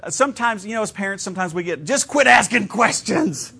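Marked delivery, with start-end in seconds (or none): 1.8-3.5 slow, higher, louder mock exasperation; exasperated parent. Pastor playing a worn-out parent begging the child to stop asking, slow and higher.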